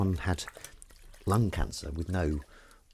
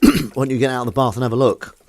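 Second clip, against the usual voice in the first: raised voice to another room; calling out; 5 semitones higher, 8 dB louder than usual. Real, not acted. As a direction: call it out to the next room.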